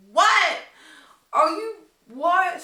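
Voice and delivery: slow, high bewildered what; stunned disbelief. Retold or imitated. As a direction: react in total disbelief. Friend pulls her away.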